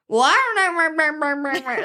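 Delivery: mumbling country-accent impression. Friend mimicking the husband on a phone call, slow country mumble. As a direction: imitate an unintelligible country man on the phone. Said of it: "If you hear him on the phone".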